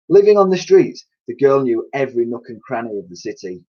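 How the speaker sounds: usual speaking voice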